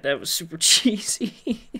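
ordinary delivery

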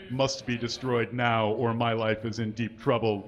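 normal talking voice

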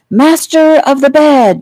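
high, slow, booming proclamation; loud announcement. A narrator's reading. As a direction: proclaim it grandly as a playing child. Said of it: "Joey announced loudly".

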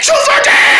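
bragging victory announcement; triumphant boast. Acted. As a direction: build to a victorious shout. Boasting after finishing the dare.

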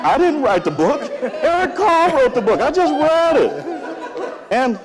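hammed-up shouting, high and loud; comically exasperated dad, pitch shooting up; protesting parent. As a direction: disown the blame at the top of your voice. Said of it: "I did what most parents would do and say".

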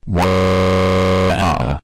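long, monotone "waaah" held on one note; robotic sustained wail; drawn-out wail. Acted. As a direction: hold a toneless cry, then drop off. Same wordless cry in acted quarrel.

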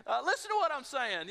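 high, loud exasperated protest; mock frustrated shout. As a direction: play the annoyed talker who is not heard. Mock frustrated opening exchange.

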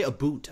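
"a boot" for about, with an oo vowel; denied Canadian about. Shown as a demonstration. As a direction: say "a boot" to rhyme with suit. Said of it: "I don't even say".

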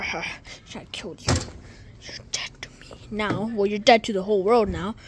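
usual speaking voice

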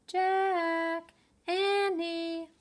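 high, sung-out call from far away; a mother calling kids home across the yard; distant call. A narrator's reading. Mom calls her children from afar, sung-out call. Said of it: "a voice called from the distance".

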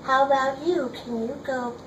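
ordinary delivery

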